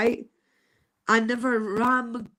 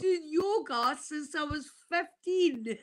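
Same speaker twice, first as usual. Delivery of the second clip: crying; 5 semitones higher, 5 dB quieter than usual. Real, not acted. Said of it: "I'm crying my heart out".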